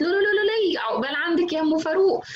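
high, festive ululation sliding into gleeful news; ululating announcer. Shown as a demonstration. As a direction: cheer the bad news like a wedding. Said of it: "المذيع التاني كده يقعد يزغرط". (مذيع بيزغرط بفرح وصوت عالي)

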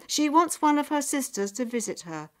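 high, loud announcing voice; messenger. A narrator's reading. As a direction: deliver the request as a proclamation. Messenger formally delivers a message.

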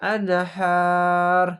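আনহার held long on "haa", final r unvowelled; stopping form. Shown as a demonstration. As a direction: stretch আনহার and stop on the r. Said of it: "এভাবে উচ্চারণ হবে".

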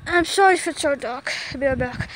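normal talking voice

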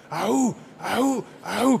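high, chanted "au, au, au" grunts; spartan war cry. Shown as a demonstration. Speaker showing the audience how to answer, a war cry. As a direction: bark it like a Spartan soldier. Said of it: "pero fuerte".

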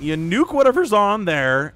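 ordinary talk, nothing marked

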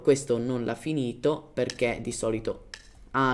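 ordinary delivery

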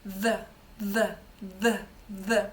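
«th» repeated, tongue tip flicked off the upper teeth; short energetic flicks of the voiced th; voiced th drill. Shown as a demonstration. Teacher shows students how to flick the voiced th. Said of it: "видох повинен бути інтенсивний".

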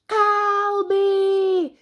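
high, loud, drawn-out call; cross sister's bellow; shouted. Acted. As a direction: shout the name angrily. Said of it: "shouted Mary".

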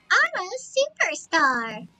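high, slow imitation of a cute character; cute game-character voice. Streamer showing how Mario Party says it, cute high imitation. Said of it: "They say it like that, and it's so cute".

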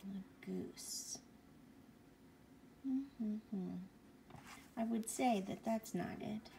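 ordinary delivery, nothing marked

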